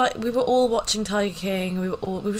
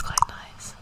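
whispering; 6 dB quieter than usual. Pretend. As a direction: still whispering, pleased with it. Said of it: "A S M R vibes".

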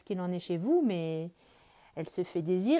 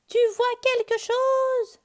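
very high, loud, slow call; 9 dB louder, slower than usual. Acted. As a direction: shout up to the bird far above. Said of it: "crie Lapin".

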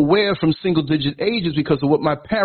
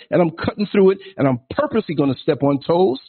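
ordinary talk

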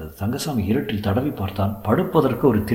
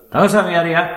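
high, raised calling voice; calling out a name; 8 semitones higher, 5 dB louder than usual. A narrator's reading. Man at morning headcount seeks Thangasamy, high calling voice. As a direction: call the prisoner out at roll call. Official calls out prisoner at headcount.

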